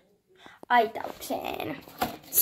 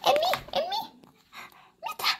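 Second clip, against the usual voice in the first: high squeaky call. Pretend. Toy horse calling its owner.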